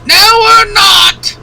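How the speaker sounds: very high, slow shrieking denial; shrill falsetto shout. Pretend. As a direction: shriek it like a Python mum. Mock shrill denial louder.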